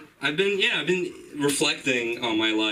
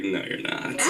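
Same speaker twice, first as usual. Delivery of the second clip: low, unvoiced whisper; 6 semitones lower, 4 dB quieter than usual. Calling out the girl's answer, a mock whisper. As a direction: breathe it as a sly accusation.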